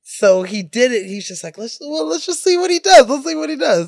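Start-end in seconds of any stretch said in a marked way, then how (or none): 1.6-3.9 high, gleeful eager voice; amused director. Retold or imitated. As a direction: egg it on like a giddy director.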